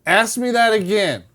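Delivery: firm hold on. Retold or imitated. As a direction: demand a repeat, firm and loud. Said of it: "Just like that, I went".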